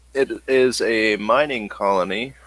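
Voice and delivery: ordinary talk, nothing marked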